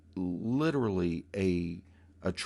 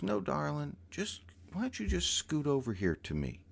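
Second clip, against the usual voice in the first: higher, lilting coaxing voice; sweet lilting voice; 7 semitones higher, 4 dB quieter than usual. Retold or imitated. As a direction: coax sweetly, sing-song. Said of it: "this beautiful, lilting voice".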